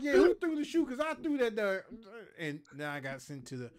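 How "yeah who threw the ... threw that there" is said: much higher, louder angry demand; angry shout. Retold or imitated. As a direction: yell it across the field, fuming. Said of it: "I was pissed off".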